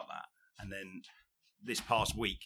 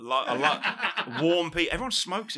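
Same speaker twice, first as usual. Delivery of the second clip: laughing; 7 semitones higher, 8 dB louder, slower than usual. Real, not acted. Laughing about Birmingham.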